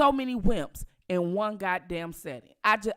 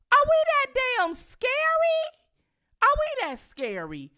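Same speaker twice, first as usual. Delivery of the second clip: much higher, louder disbelieving cry; incredulous outburst; 6 dB louder than usual. Real, not acted. Asking viewers about the crowd that didn't help, loud incredulity. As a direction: shout it in disbelief. Outraged at bystanders not helping.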